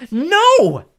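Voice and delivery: high, loud scream of no. A narrator's reading. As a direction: shriek it out in despair. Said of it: "Circe screamed".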